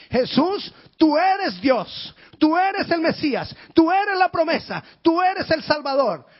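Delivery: high, loud, fervent calling out; proclaiming shout. Acted. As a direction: cry it out like a proclamation. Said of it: "Qué le estaba diciendo a Jesús".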